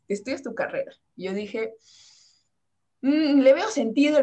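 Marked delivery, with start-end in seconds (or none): 3.0-4.2 mock convinced. Quoting herself agreeing, slow and loud.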